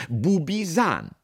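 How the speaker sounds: loud, high, slow name; proud product presentation. A narrator's reading. Boss showing the soap version, proudly proclaiming. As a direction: sing out the brand name grandly.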